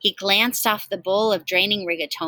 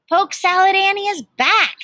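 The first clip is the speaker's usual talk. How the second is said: high, loud, announcer-style headline; 11 semitones higher, 5 dB louder than usual. A narrator's reading. The town headlines announce Annie's diner is back. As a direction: boom it like a newspaper headline. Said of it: "the headlines read".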